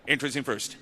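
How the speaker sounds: normal talking voice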